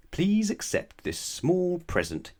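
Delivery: high, slow, sugary offer; hammed-up sly gift-giver. A narrator's reading. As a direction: lay it on sweetly to trick her. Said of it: "he said to her".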